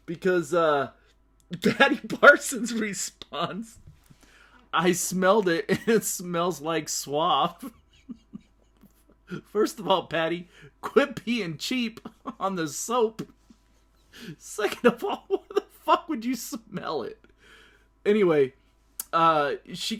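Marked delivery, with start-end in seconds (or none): 1.6-9.4 slow, high, laughing through it; barely holding it together. Real, not acted. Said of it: "This is a tough episode for me". 14.4-17.2 high-pitched laughing disbelief. Real, not acted. Laughing at Patty's smelling it.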